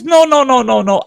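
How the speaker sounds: high, loud flustered no's; panicked protest. Retold or imitated. The warden refusing to pay for the car, panicked no's. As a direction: blurt out frantic denials.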